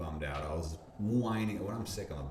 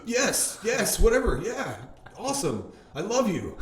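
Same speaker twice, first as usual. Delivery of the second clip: much higher, louder, gushing babble; overexcited yes; 13 semitones higher, 9 dB louder, slower than usual. Retold or imitated. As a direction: blurt out yes to everything, starstruck. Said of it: "I'm talking now is exactly how I was on the phone".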